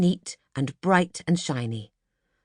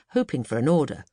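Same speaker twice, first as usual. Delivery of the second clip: usual speaking voice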